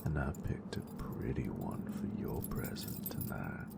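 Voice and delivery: soft, close, teasing whisper to a lover; intimate whisper. Acted. Teasing her nerd with lights, close intimate whisper. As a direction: whisper it close, possessive and playful.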